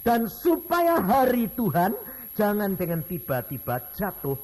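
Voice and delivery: slow, loud solemn Bible reading; scripture reading. Acted. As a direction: read the verse as a solemn warning. (membaca ayat Alkitab dengan khidmat)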